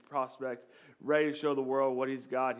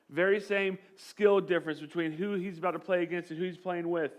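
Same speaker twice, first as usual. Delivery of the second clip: normal talking voice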